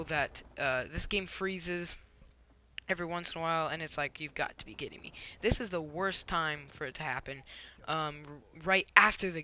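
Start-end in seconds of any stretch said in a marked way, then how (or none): 4.2-5.1 retold exasperation, kept soft; quiet, deflated disbelief under the breath; muted disbelief. Retold reaction to yet another freeze.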